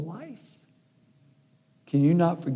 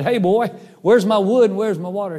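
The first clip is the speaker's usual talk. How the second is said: loud, raised-pitch demand; acted-out bully calling the boy out; demanding mean man; 5 semitones higher, 5 dB louder than usual. Mean man demands wood and water.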